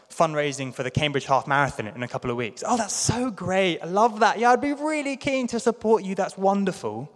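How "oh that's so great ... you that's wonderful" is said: high, gushing mock praise; mock enthusiasm. Listener promising a fundraiser support, high gushing mock cheer. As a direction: overdo the keen supporter.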